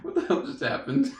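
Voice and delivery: high, laughing what-the-hell; laughing disbelief. Retold or imitated. Teen asks teammates what just happened, high and laughing. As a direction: ask it through laughter. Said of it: "I'm like".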